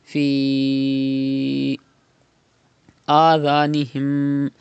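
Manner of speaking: Fi held long before adhanihim; five-count stretch. Shown as a demonstration. Teacher demonstrates madd munfasil, long held. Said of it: "stretching up to five harkat".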